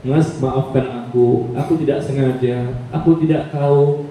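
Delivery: a lightly voiced sorry wife; apologetic wife. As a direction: plead for forgiveness tenderly. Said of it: "dia minta maaf".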